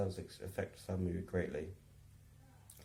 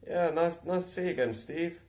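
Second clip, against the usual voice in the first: high, loud put-on politeness; overly chirpy, playing along; mock polite reply; 10 semitones higher, 5 dB louder than usual. Replying politely to Steve in the shop, mock chirpy. His own reply, replayed mockingly.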